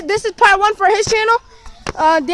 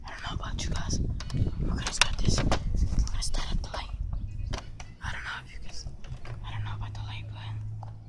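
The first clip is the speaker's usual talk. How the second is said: whispering; 12 dB quieter than usual. Real, not acted. Hiding, whispering to camera.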